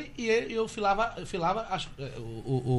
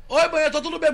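calling out loud into a payphone; loud phone hello; 8 semitones higher, 7 dB louder, faster than usual. Retold or imitated. Son shouting hello to his mother from a German payphone, loud. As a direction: shout hello to mum down a bad line. Retold phone call cut off.

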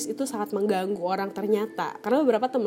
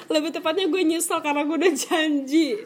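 laughing regret; 4 semitones higher, 4 dB louder than usual. Real, not acted.

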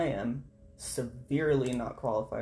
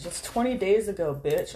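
annoyed; 5 semitones higher, 5 dB louder than usual. Real, not acted. Annoyed at a stale listing.

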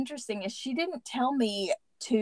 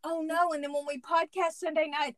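normal talking voice

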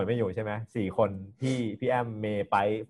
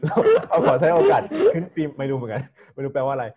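laughing; 2 semitones higher, 6 dB louder than usual. Real, not acted. Laughing at own odd wording.

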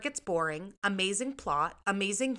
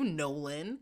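ordinary talk, nothing marked

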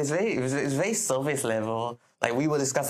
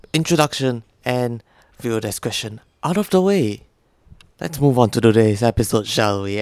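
podcast intro; 8 dB louder than usual. Acted. Podcast host opening.

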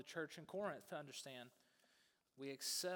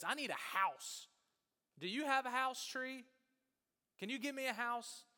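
loud, higher-pitched pleading demand; insistent demand; 9 semitones higher, 8 dB louder than usual. Acted. Grown boy asks the tree for a house, loud and insistent. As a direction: press the tree hard, voice raised.

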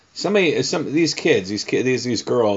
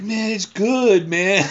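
put-on higher, slow boastful kid voice; fake bravado; 11 semitones higher, 5 dB louder, much slower than usual. Man replays his younger self bluffing about tasting. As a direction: stretch it out like a bluffing boy. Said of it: "It's like".

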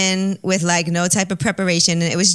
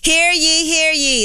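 town crier; 8 semitones higher, much slower than usual. Acted. Opens an ad like a crier.

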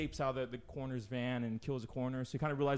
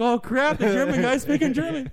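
much higher and louder, laughing panic; mock shock; 11 semitones higher, 12 dB louder than usual. The kids realizing the neighbor is German, in laughing mock shock. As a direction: shriek it in laughing fake alarm. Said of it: "they turn around like".

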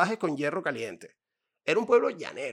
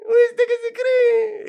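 even higher, louder, slower gocho ¿Viste que se cree?; louder gocho accent; 11 dB louder, much slower than usual. Retold or imitated. Gocho greeting a friend abroad, loud high gocho accent. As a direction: push the gocho line bigger. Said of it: "vamos a hablar gocho".

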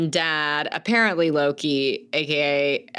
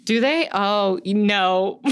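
usual speaking voice

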